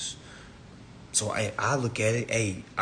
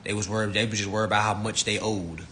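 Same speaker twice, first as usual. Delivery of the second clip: normal talking voice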